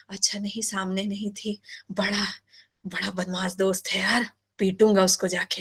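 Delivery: half-whispered, playful mock threat to a friend; relieved muttering. Student's relieved muttering retold.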